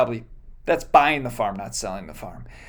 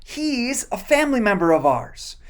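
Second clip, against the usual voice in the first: Naomi recognizing; 5 semitones higher than usual. Retold or imitated. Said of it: "And Naomi says".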